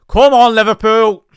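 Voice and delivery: big loud yell, pitch leaping up; shouting encouragement. Real, not acted. Fan cheering Liverpool on at kickoff, full shout. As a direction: roar the team forward from the stands.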